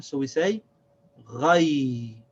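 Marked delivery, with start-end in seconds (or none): 1.2-2.2 ghay drawn out, throaty gh gliding into y; connected sounds. Shown as a demonstration. Teacher connects ya with ghain, ghay as one long syllable. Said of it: "connect this ya with this ghain".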